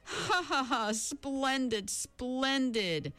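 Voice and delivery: high, slow gloating laugh; mock laughter. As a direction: laugh it out, pleased. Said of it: "break up into laughter".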